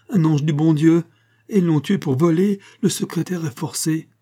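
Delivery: high, loud, agitated lament; tearful, distraught outcry. A narrator's reading. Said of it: "qui buvait ses larmes". (lamentation éplorée)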